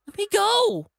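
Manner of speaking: high, loud, slow desperate cry; terrified plea. Acted. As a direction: beg to be released. TJ struggling free.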